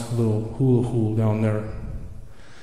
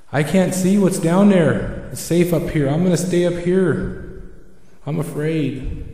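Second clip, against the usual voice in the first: higher-pitched, worried owl; frightened owl; 5 semitones higher than usual. Acted. Owl hearing footsteps on the forest floor, afraid. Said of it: "Again, Owl gets afraid".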